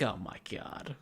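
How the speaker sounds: whispered disbelief under the breath; exasperated whisper. Retold or imitated. Clerk told the receipt isn't right, exasperated whisper. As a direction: despair privately, almost without voice.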